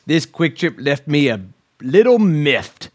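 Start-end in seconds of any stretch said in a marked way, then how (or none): 1.8-2.9 slow, stretched little miffed; drawn-out miffed. Acted. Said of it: "read 'em in a funny voice".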